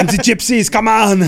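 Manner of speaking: hammed-up shouting Austrian strongman; Arnold Schwarzenegger. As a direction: yell like an Austrian action hero. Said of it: "Arnold Schwarzenegger, how did you".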